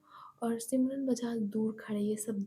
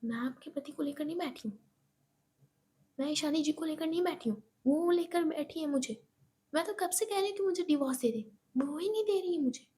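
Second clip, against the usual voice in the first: rapid, higher, defensive; fast heated rebuttal; 3 semitones higher, faster than usual. Acted. Eva hits back about the husband, rapid and heated. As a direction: spit it out quickly. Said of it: "Eva कहती है".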